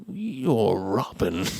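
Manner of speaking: very slow, low and soft mock-villain voice; imitated slow-dawning recognition; stunned villain. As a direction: drag it out in low disbelief. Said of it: "Rickman has this look of".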